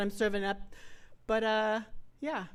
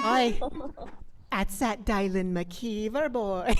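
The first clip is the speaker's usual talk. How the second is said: Irish brogue; 3 semitones higher, 4 dB louder, slower than usual. Retold or imitated. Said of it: "very redheaded Irish boy".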